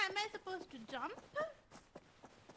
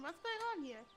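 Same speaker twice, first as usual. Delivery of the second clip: normal talking voice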